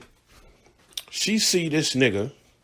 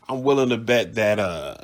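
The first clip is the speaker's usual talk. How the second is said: normal talking voice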